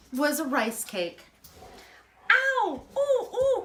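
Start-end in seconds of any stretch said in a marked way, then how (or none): 2.3-3.6 put-on high, loud child's cry of pain; little boy crying out. The boy cries out when the duck snatches his cake, high yelp. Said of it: "cried the little boat".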